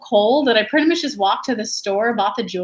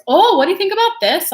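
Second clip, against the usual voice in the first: chatty driver asking, pitched up and louder; cab driver; 8 semitones higher, 5 dB louder than usual. Retold or imitated. An eager cab driver quizzing the American tourist, pitched up and louder.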